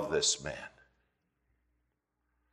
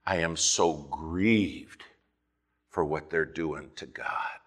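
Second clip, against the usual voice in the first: grieved for God; 6 semitones lower, 8 dB quieter than usual. Acted. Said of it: "I hardly ever ... hear somebody say".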